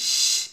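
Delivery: শ as a long voiceless hiss. Shown as a demonstration. Teacher models the sound of shin, hissing শ. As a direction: let only air out on a sustained শ. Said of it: "এভাবে শব্দ করেন".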